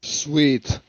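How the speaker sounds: ordinary talk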